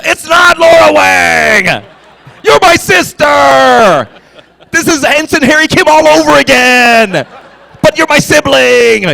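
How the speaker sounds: high, drawn-out comic shout at a sibling; exasperated mock yelling. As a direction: wail it loudly, stretching the last words. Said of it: "why I just talked like that".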